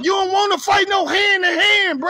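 high, loud shouted threat; angry shout. Real, not acted. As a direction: yell the challenge at him. Heated callout rant, shouting.

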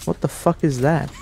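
startled high cry into a curse; panicking gamer. Real, not acted. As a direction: jump and swear in shock. Startled by something in game.

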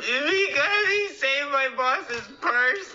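slow, high-pitched blubbering; tearful wailing. Acted. Officer explains the lost pay to buddy, wailing. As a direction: sob the story out slowly, high.

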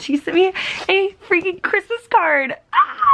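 high squeal at the end; excited high-pitched shriek. Real, not acted. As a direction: squeal with joy. Squealing over the card.

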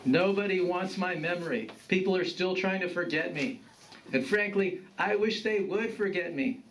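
lightly voiced disgruntled grandfather; world-weary grandfather. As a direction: grumble a little, then question your grandson.

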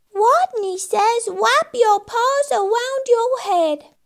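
high, slow little rodent voice with w for r; small, lisping squeak; squeaky rodent. Acted. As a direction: squeak out a playground command. Timid rodent's squeaky command.

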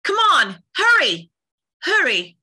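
high, loud urging; urgent game-heroine shout; urgent calling. Acted. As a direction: hurry someone along with a high call. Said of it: "could you also say".

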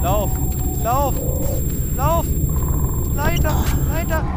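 panicked high shouts; being chased. Real, not acted. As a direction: yell at yourself to run. Fleeing to ladder in game.

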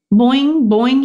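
high, loud bouncy boing; frog boing. Acted. Narrator voicing Og the frog, high and bouncy. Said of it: "he said".

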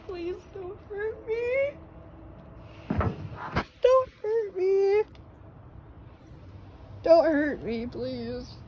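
high, slow, crying plea; terrified horror victim. Pretend. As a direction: beg for mercy, whimpering. Pleading with attacking creature.

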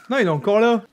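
usual speaking voice

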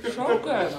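laughing with delight at food. Real, not acted. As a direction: chuckle it, delighted. Tasting the bread, laughing.

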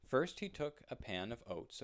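usual speaking voice

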